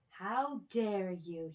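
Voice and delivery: slow, loud indignation; outraged, drawn out; indignant. A narrator's reading. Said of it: "he said".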